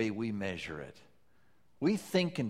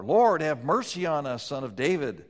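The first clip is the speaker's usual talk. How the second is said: crying out; 6 semitones higher, 8 dB louder than usual. Retold or imitated. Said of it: "They cried out all the more".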